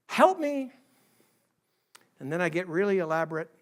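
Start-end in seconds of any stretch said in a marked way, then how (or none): 0.1-0.7 high-pitched little plea; two-word prayer. Acted. Preacher demonstrating his expanded two-word prayer, high plea. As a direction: plead briefly, voice pitched well up. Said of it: "it becomes two words".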